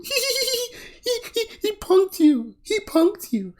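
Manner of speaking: high-pitched mocking giggle voice; squeaky, giggly imitation of a taunting stripper; giggling stripper. Critic mimicking a giggling stripper on a show, high mock giggle. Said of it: "is giggling, going".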